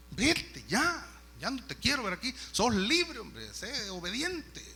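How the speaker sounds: drawn-out, emphatic exasperation; exasperated master. Acted. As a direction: send him off impatiently, voice rising. Master exasperated, ordering slave to leave.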